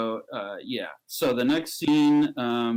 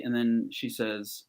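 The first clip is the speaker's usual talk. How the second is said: ordinary delivery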